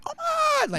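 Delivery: squealed high oh my God; high, gushing delight; gushing Angelo. Retold or imitated. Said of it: "he's always like".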